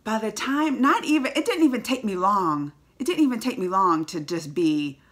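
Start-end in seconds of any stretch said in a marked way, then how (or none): none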